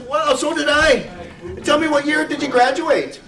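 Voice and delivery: thrilled pub bloke in a put-on Irish brogue; excited Irish brogue. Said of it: "the first guy gets really excited".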